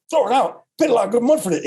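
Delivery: fast, high Scottish accent, lightly imitated. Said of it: "In his Scottish accent".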